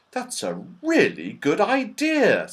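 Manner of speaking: bright-pitched delight at the idea. A narrator's reading. Joe welcomes Moonface's idea, delighted.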